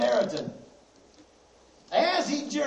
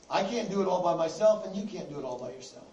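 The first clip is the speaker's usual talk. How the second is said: ordinary talk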